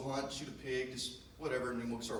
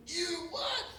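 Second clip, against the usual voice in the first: much higher, louder squeak of surprise; disbelief; 4 dB louder than usual. Retold or imitated. Dad stunned that his son shot a deer, yelping. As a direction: yelp in disbelief.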